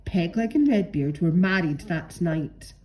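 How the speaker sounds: ordinary delivery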